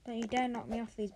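normal talking voice

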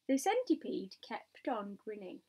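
ordinary delivery

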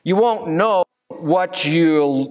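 usual speaking voice

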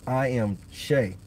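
ordinary talk, nothing marked

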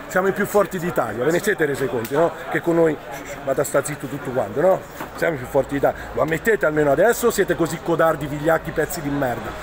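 usual speaking voice

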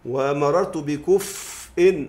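بكفء drawn out slowly as bikuf-in; full case ending. Shown as a demonstration. Teacher giving the example in full, بكفء said slowly as bikuf'-in. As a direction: hold the f in بكفء, then sound "in". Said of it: "أقول هذا إيه؟".

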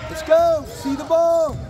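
cheering shout. Real, not acted. Parent cheering at basketball game.

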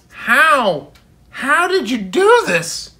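high, loud, stunned how; shocked father. A narrator's reading. Father sees the haircut, shocked and loud. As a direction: cry out in disbelief at the haircut.

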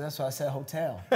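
lightly imitated Southern cook insisting; Southern accent. Cook defending his pronunciation to chef, imitated Southern accent. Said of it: "with this thick Southern accent".

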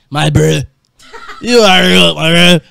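loud, slurred "You are a goat, my bro"; garbled, unintelligible. Real, not acted. Overwhelmed streamer praising a donor, too garbled to follow. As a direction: blurt "my bro" mushily through laughter, words collapsing. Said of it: "I need subtitles".

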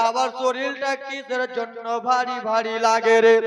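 loud, wailing sermon chant; impassioned preaching. Acted. Preaching at full force. (উচ্চস্বরে আবেগী ওয়াজ)

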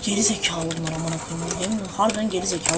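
low, soft, fast grumbling; muttered grumble. Real, not acted. Annoyed at the kids, muttering low and soft. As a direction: grumble under your breath.

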